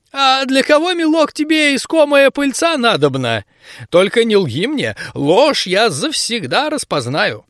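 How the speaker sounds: high, probing granny voice; suspicious old woman. Acted. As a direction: squint and quiz him like a crone. (подозрительная бабка)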